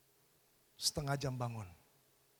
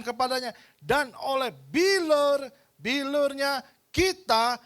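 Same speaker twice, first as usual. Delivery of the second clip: preaching scripture at full force; 8 semitones higher, 9 dB louder than usual. Acted. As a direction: declaim each phrase like a promise. Loud scripture proclamation.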